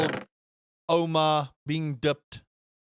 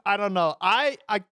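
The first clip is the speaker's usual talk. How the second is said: ordinary talk, nothing marked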